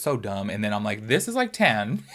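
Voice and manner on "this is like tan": laughing. Real, not acted. Laughing at her own neck tan.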